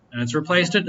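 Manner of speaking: ordinary talk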